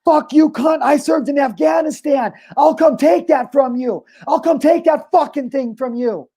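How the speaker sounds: high, drawn-out reenacted shout; imitated screaming. Retelling an Afghanistan veteran confronting a lady, screaming at her. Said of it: "Screaming at her".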